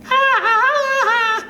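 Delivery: very high, loud staccato cry; loud, piercing boyish outburst; boy's mocking cry. A narrator's reading. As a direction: pulse it out like a high cackle. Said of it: "you hear a boy's voice".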